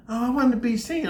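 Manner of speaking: put-on high, whiny girlfriend voice; mocking girlfriend. Girlfriend's voice, mocked.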